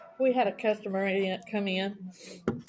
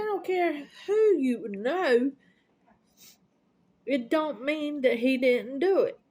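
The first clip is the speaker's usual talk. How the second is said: mock argument; 5 semitones higher, slower than usual. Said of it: "a yelling match".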